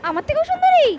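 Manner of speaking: rising to an indignant jealous shriek; jealous outburst. Acted. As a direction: flare up with wounded jealousy.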